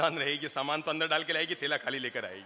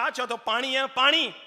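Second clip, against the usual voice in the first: scoffing; 9 semitones higher, 7 dB louder than usual. Retold or imitated. Brother-in-law scoffs at watery buttermilk.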